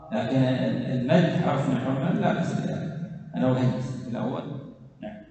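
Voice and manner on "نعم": usual speaking voice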